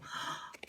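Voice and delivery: a quick breathless intake of air; sharp surprised gasp. Real, not acted. Reader turning a card, sharp gasp of delight. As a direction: catch your breath at a lovely surprise.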